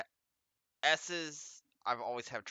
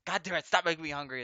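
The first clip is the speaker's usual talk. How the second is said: loud, fast annoyed rant; frustrated outburst; 6 semitones higher, 7 dB louder, faster than usual. Real, not acted. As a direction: snap at the screen in frustration. Frustrated outburst at food text.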